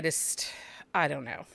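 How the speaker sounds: whispered aside, trailing off; whispering. Real, not acted. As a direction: drop to a whisper, unsure. Trailing off, unsure about signing.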